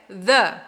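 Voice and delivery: "the" with a short uh vowel; "the" as thuh. Shown as a demonstration. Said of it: "a segunda pronúncia é".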